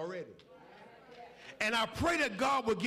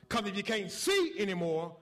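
usual speaking voice

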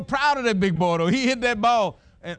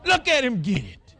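proud tearful cheer; 7 semitones higher than usual. Retold or imitated. Father in the stands cheering his son, tearful cheer. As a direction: cry out with pride. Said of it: "I started crying".

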